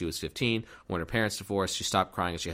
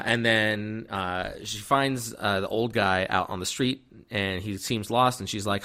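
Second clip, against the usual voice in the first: normal talking voice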